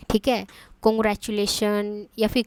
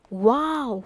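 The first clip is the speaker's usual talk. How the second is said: mild wow; much slower than usual. Shown as a demonstration. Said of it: "अपनी strong feeling जाहिर किया".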